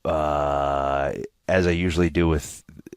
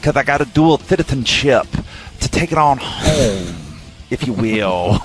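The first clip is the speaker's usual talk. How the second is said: Dusty Rhodes; 7 semitones higher than usual. Retold or imitated. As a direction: ham up a Texan wrestling promo. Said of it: "I had to get my Dusty in again".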